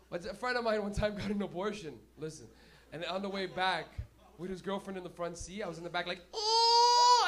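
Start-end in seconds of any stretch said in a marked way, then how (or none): 6.3-7.3 put-on high loud baby wail; fake baby wail. Comic wailing like a baby in the back seat, high and loud. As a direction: wail like a newborn.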